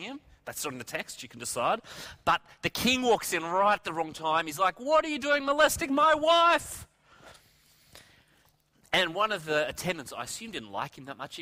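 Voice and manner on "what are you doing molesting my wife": high, loud acted fury; outraged shout. King walks in on Haman and his wife, outraged shout. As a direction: roar it as a scandalized husband.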